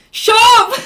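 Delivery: loud, high laughing shout of shut up; laughing yell. Retold or imitated. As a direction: yell it at him through laughter. Hushing friend next to worker.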